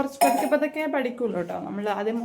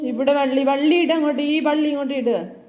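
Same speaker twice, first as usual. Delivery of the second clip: firm, raised-voice instruction; real raised teaching voice; loud correcting; 3 semitones higher, 7 dB louder than usual. Real, not acted. Mother correcting child's handwriting.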